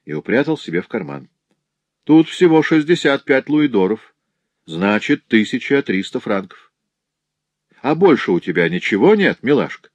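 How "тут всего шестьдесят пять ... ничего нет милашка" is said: higher-pitched, cheeky bailiff voice; lightly voiced bailiff, sizing up the takings; bailiff counting money. Пристав считает деньги. (голос наглого пристава, повыше)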